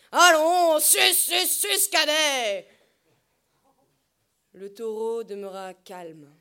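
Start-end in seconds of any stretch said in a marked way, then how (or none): 0.1-2.7 exhortation criée. Acted. Berger pousse le taureau.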